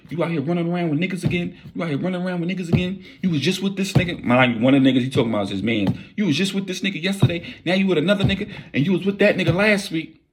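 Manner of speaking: high, fast mock jealous rant; hammed-up high jealous accusation; jealous husband.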